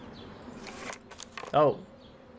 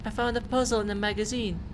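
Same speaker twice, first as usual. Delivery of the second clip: put-on girl voice; 7 semitones higher than usual. Player voicing Lena dialogue.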